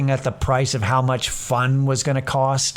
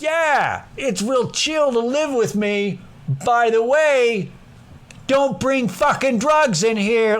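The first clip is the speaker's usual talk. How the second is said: higher, slow put-on pitch voice; hammed-up honest ad read; mock blunt salesman; 9 semitones higher, slower than usual. Mocking how he would write a roommate ad, higher and slow.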